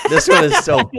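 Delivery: high, loud mock squeal. Co-host mocks streamer's nose job photo, high mock squeal. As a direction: squeal it like a shocked fan.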